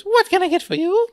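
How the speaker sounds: very high, hammed-up waiter voice; waiter impression. Waiter suddenly greeting the table, hammed-up high voice. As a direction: imitate the waiter with pitch shot way up. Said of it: "all of a sudden he goes".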